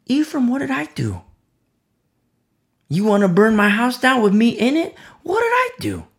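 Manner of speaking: high-pitched, pleading protest of a wronged man; exasperated Jephthah. Acted. Jephthah protests to Ephraim about the threat, high-pitched protest. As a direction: voice climbing high on the questions. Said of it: "Jephthah's like".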